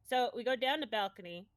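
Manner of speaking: normal talking voice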